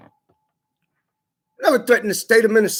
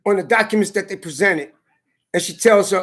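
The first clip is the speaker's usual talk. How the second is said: ordinary talk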